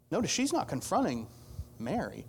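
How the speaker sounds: ordinary talk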